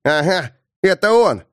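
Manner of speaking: gloating, sly little jab; snide. Acted. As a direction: sneer it out triumphantly. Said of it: "ехидно сказал чиж".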